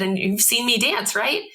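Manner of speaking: put-on sing-song reassurance for a child; talking to a small child. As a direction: explain it sweetly to a little girl.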